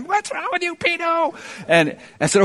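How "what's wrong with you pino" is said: mock scream. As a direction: imitate a panicked scream in a much higher voice.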